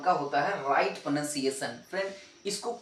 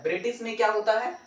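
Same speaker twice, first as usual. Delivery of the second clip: ordinary talk, nothing marked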